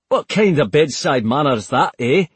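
put-on thick Scottish accent, joking through pain. Burned soldier teases the healers in a thick Scottish accent. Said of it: "in a thick Scottish accent".

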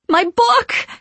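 loud, high alarmed cry. A narrator's reading. Winifred seeing her book stolen, crying out. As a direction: wail it in outrage. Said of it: "cried Winifred".

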